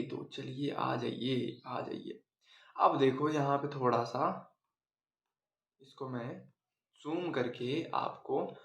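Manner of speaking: soft, low talk half to self; low murmur. Real, not acted. Teacher zooming the screen for students, low absent murmur. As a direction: murmur slowly while busy with something.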